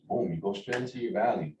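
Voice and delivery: ordinary talk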